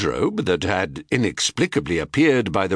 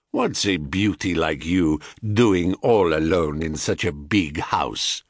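put-on low seducer in a Thracian accent; deep, forceful stranger with Thracian accent; thick Thracian accent; 6 semitones lower than usual. A narrator's reading. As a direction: put on a heavy Thracian accent, pitch dropped. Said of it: "in a thick Thracian accent".

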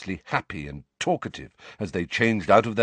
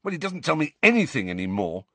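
higher, frustrated complaint; impatient; 9 semitones higher than usual. A narrator's reading. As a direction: let the grievance spill out impatiently. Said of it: "said Harry impatiently".

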